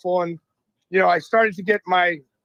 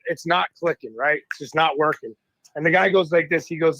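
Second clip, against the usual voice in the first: normal talking voice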